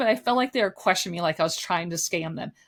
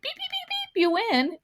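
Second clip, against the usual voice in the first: tiny high-pitched game-win jingle voice; happy winning beeps. Retold or imitated. Customer imitating the beep it was not, high winning chirps. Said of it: "not the, like".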